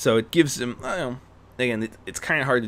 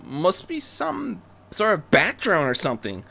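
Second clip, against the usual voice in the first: laughing female Joker; 6 semitones higher than usual. Acted. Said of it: "one of the female Jokers laughed".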